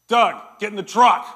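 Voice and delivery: loud barked command across the yard; yelled order. Retold or imitated. Outfitter yelling at Doug from his truck. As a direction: shout it from the truck window. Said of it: "he yells over and he goes".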